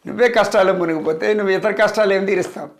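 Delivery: usual speaking voice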